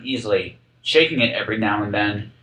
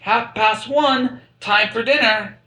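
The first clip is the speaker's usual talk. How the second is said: high, slow sing-song call; high sing-song announcement; lightly voiced cheery call; 11 semitones higher, slower than usual. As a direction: announce dinner time gleefully. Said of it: "whisper a hint of time".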